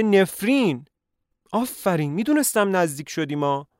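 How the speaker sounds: put-on high, loud eager old doctor; excited doctor. Doctor to Leon, loud and thrilled. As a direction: burst out like a thrilled country doctor. Doctor character voiced loudly.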